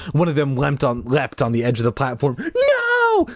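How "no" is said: high cry of alarm; frightened cry. Acted. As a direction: cry out in fear. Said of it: "several kids cried in unison".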